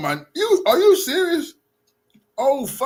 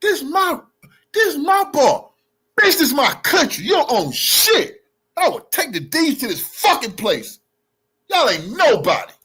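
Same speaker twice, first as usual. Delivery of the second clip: re-enacted hollering. Retelling how he yelled at the bar, hollering. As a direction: holler it like you're reliving the argument. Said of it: "I'm hollering, yelling".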